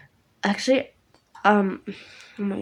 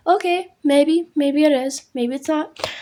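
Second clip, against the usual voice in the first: unsure high voice; 5 semitones higher, 5 dB louder than usual. Retold or imitated. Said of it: "And I'm like".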